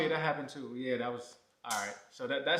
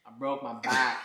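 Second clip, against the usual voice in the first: meme quote; 9 dB louder than usual. Pretend. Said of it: "Sorry, I had to".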